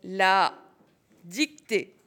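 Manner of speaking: slow, spaced-out dictation; dictating. Shown as a demonstration. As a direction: drop each word slowly for the class. Teacher dictating word by word.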